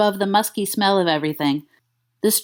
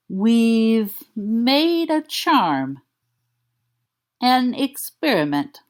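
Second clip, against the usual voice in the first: slow, slightly high dog voice; a hint of dopey dog; 3 semitones higher, much slower than usual. Dog voice explaining slowly.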